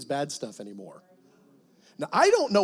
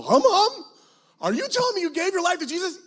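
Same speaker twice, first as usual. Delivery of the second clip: high, loud astonished question; shocked; 11 semitones higher, 4 dB louder than usual. Retold or imitated. Pastor retelling his astonished question to Hum Hum. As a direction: gasp it out in disbelief.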